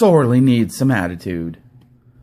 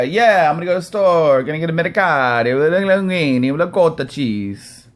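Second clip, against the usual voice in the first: hammed-up New York Italian accent; Italian-American accent; 3 semitones higher, 3 dB louder than usual. Host mocking pasta Italians naming food, exaggerated Italian accent. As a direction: roll into a singsong fake Italian accent. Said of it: "all of a sudden they become Italian Italian".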